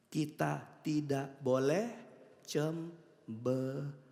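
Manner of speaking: very slow, low, soft delivery; deliberate teaching. Real, not acted. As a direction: break it syllable by syllable. Spells out lesson syllable by syllable.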